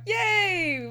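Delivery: bouncy fake celebration; mock cheer. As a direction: cheer like it's all solved.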